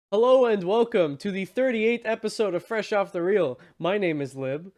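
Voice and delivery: bright, loud host opening; show host intro. Acted. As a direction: open the show like a podcast host.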